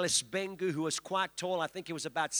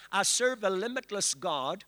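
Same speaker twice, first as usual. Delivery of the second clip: leading a declaration; 3 semitones higher than usual. Shown as a demonstration. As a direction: lead the congregation's declaration. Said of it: "lift your hands and say".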